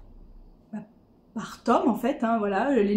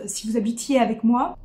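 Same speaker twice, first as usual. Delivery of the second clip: usual speaking voice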